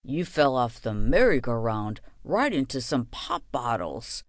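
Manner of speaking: low grandfather voice; grandpa voice. A narrator's reading. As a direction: drop low for old Gramps. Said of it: "my Gramps added".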